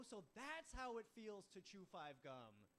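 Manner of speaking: high, slow, wowed exclamation; mock revelation. Comedian to woman after sleeping together, mock-amazed revelation. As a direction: draw it out in mock wonder.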